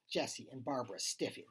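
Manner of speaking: ordinary delivery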